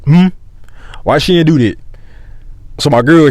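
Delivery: ordinary talk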